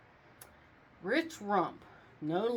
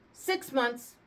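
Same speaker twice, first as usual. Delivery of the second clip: curt, higher verdict; snapping; 6 semitones higher, 4 dB louder than usual. A narrator's reading. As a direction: snap the sentence out. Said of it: "the judge snapped".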